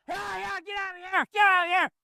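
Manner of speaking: high yelled yeahs, get out of here; shooing calls. Shown as a demonstration. Trainer shows how to drive a mule from his space, high calls. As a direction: chase it off with high sharp calls.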